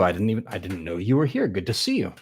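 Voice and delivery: ordinary talk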